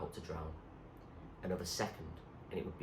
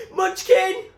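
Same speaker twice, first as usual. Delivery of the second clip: high, loud scream of his name; 15 dB louder than usual. A narrator's reading. Zoe sees Munchkin fall overboard, loud scream. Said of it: "she screamed".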